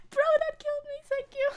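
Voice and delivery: high, tearful laughing squeal; laughing until the voice goes high; dying of laughter. Real, not acted. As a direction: squeak out thanks while cracking up.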